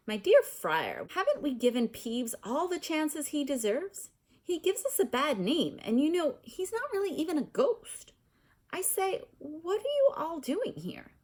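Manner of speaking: haughty ghost arguing over Peeves; indignant ghost. Acted. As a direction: protest grandly, then notice the children. Ghost argues with the friar.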